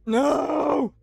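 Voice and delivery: high panicked shout; scared scream. Real, not acted. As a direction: scream in fright. Jumpscared by Funtime Freddy.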